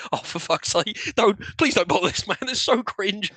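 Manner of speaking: ordinary talk, nothing marked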